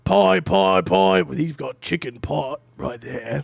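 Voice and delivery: loud, slow, proud half-orc baker; Gatoria, proud baker. Acted. Half-orc baker showing off her pies, loud and proud. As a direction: boom out the pie list like a proud matron. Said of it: "And Gatoria looks down and she goes".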